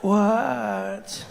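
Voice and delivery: drawn-out sleepy groaned question; annoyed tired woman. Acted. Woman woken by pestering partner, drawn-out weary reply. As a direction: answer half asleep and irritated.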